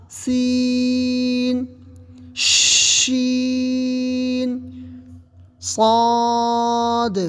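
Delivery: each letter held very long and slowly; slow recitation of letters; drawn-out letter names. Shown as a demonstration. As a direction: stretch each name evenly.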